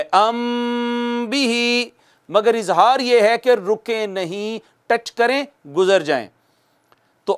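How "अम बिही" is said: very slow अम बिही with the meem held and hidden. Shown as a demonstration. Teacher showing ikhfa on a hummed meem. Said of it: "इख्फा में भी रुकना होता है".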